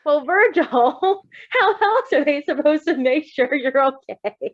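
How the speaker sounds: giggly doctor line, dragged out; laughing through it. Acted. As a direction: voice the doctor while cracking up. Reader voices doctor, laughing.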